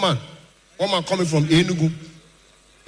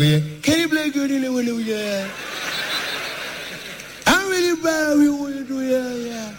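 higher, foreign-sounding mock Trump; Trump impression; 6 semitones higher than usual. Trump phoning the comedian, mock American voice. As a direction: ham up an American president.

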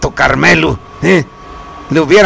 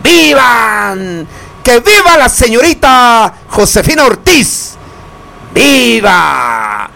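ringing cheers with stretched names; celebratory cries to the listeners; festive viva shouts; 4 semitones higher, slower than usual. Acted. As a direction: call out toasts like a fiesta announcer. Radio host calling out vivas.

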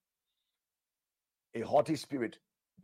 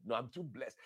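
ordinary talk, nothing marked

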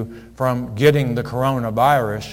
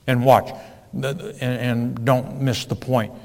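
ordinary delivery

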